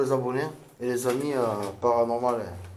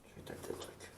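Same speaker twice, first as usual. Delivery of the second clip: hushed whisper to the camera; 23 dB quieter than usual. Real, not acted. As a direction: whisper it so nobody hears. Unvoiced aside before trying.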